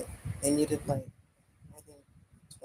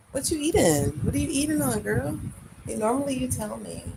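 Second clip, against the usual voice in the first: high, loud playful teasing; 9 semitones higher, 7 dB louder than usual. Real, not acted. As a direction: tease her like a nosy friend. Teasing friend about eating.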